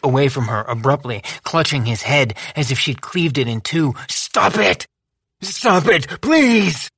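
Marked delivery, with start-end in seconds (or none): 4.1-6.9 high, anguished plea; pained begging. A narrator's reading. Boz to Lou, high, pained pleading. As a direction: beg through the pain.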